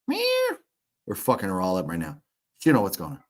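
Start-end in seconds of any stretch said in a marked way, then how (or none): 0.1-0.6 meow at cat. Pretend. Meowing at cat via camera.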